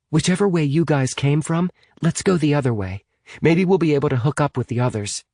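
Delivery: put-on high girl's voice, urging; urgent Amy. Amy steering the group away from marchers, urgent. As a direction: pitch it high and press them onward.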